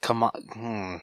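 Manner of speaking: ordinary delivery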